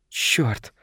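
soft, far-off, otherworldly; as if from somewhere far away; distant otherworldly voice. A narrator's reading. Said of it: "каким-то далёким потусторонним голосом".

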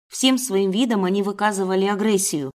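ordinary delivery

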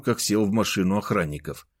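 usual speaking voice

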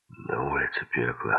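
low-pitched, with a mild weary sigh. A narrator's reading. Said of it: "вздохнул он".

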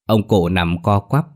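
ordinary delivery, nothing marked

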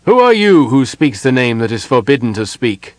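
loud, commanding stranger's voice; stern challenger. A narrator's reading. Hidden elf challenges the intruder, sternly. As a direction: demand an answer, loud and stern.

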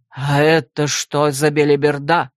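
low, slow, threatening question; menacing. Acted. Rector reads her mistakes, low and menacing. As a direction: loom over her with a low voice. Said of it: "грозно поинтересовался Лер Стерлинг".

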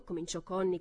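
plain reading voice

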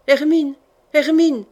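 acted cry to a friend. A narrator's reading. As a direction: call out the name in alarm. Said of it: "cria Laurence".

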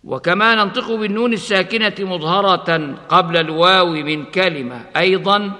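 usual speaking voice

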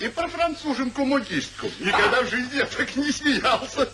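high, chuckling through the words; laughing. Acted. Friend laughing about the falling screen. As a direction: still giggling at the memory. Recalls the funny scene.

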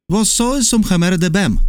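"Vos sois un hammer de bem" mouthed wide, high and careful; over-articulated Portuguese. A narrator's reading. Geographer addressing the Patagonian, each syllable of "Vos sois un hammer de bem" over-articulated. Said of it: "широко открывая рот, чтобы яснее выговаривать".

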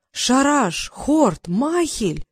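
stunned naming, one by one; each name a fresh jolt of recognition; shocked exclamation. A narrator's reading. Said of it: "потрясённо воскликнул Трикс".

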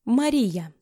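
plain reading voice

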